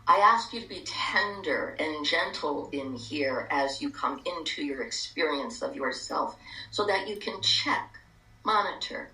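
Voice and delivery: calm guided meditation, hushed. Acted.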